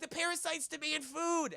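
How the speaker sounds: high mock-child voice; hammed-up kiddie whine; whining kids. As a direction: chant it like demanding children. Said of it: "the kids are like".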